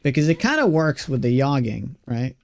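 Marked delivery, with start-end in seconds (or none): 1.4-2.0 "jogging" said as "yogging"; Swedish J-as-Y. Shown as a demonstration. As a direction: say "jogging" with Y in place of J. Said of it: "He pronounces J's like Y's".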